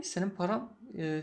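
normal talking voice

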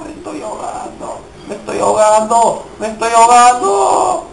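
desperate cries while drowning; panicked drowning cries. Real, not acted. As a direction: gasp it out, running out of air.

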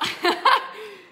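loud, high laugh. Real, not acted. Host agreeing with the lumberjack joke, loud laughing.

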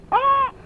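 sudden high yelp of pain; sharp yelp. Real, not acted. Yelping as the dog jumps on her, sudden pain. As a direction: yelp as the dog hurts you.

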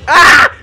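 short high loud shriek; startled scream. Real, not acted. Gamer caught by exploding mob, startled scream. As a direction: shriek at the sudden blast.

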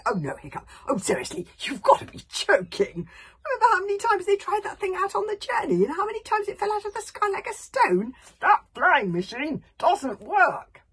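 loud, high, panicked protest; alarmed protest. A narrator's reading. Fishlegs protests loudly.

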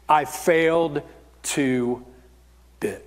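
low, halting admission; dejected, broken into separate words; defeated. Acted. Imagined Peter's despair.

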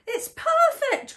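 high-pitched happy exclamation; high, thrilled storybook voice; delighted cry. A narrator's reading. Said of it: "cried the animals".

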